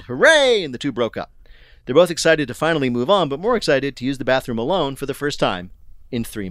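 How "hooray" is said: high, loud mock hooray; retold cheer. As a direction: imitate a loud, high yell of joy. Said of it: "the woman yelled".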